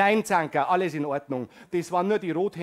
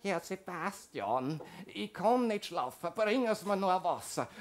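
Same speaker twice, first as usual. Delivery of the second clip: a resident calling the nurse at night; sleepy old lady's plea; frail old resident; 7 dB quieter than usual. Acted.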